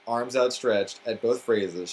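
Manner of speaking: usual speaking voice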